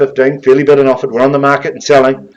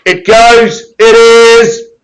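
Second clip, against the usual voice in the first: brisk, higher auction call, driving the bids; auctioneer calling; 6 semitones higher, 4 dB louder than usual. Acted. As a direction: rattle off the numbers like a live auctioneer. Auctioneer closing the sale.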